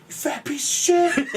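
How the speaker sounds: high-pitched, strained mock yelling; mock trainer yell. Man imitating somebody yelling in his face, mock yell. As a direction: yell abuse like a drill-sergeant trainer, played for laughs. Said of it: "I need somebody yelling in my face".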